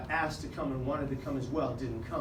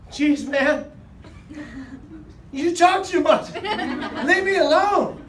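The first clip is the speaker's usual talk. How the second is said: much higher, louder, slow exasperated voice; whiny teen; 10 semitones higher, 7 dB louder, much slower than usual. Retold or imitated. Kid complaining the comedian talks too much, high and whiny. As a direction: play the fed-up catatonic kid, dragged out. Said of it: "He goes like this".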